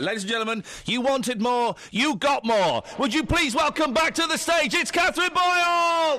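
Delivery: high, building showbiz introduction; stage announcer. Pretend. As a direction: bring her on like a star, name held long. Mock show introduction of newsreader.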